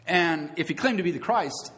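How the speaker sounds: ordinary delivery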